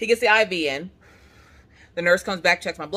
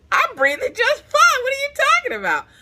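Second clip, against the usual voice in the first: squealed exasperated retort; indignant protest; 5 dB louder than usual. Retold or imitated. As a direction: argue back in mock outrage. Acted indignant reply to nurses.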